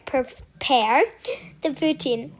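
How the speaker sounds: ordinary delivery, nothing marked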